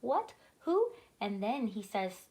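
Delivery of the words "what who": high, startled questions; voice gone funny. A narrator's reading. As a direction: pitch leaps up in shocked disbelief. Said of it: "voice goes all funny".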